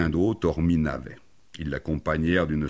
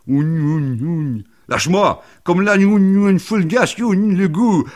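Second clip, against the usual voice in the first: loud, high yelping; hammed-up high-pitched outburst; frantic struggle; 10 dB louder, slower than usual. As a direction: yell through a slobbering tussle. Boy licking hand, struggle over it.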